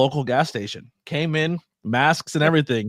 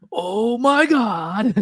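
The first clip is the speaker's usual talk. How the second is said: high, slow anime exclamation; anime narrator; 8 semitones higher, 3 dB louder, much slower than usual. Pretend. Co-host mimics Japanese animation, high mock anime voice. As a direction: draw it out like overdramatic anime narration. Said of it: "like, in an anime".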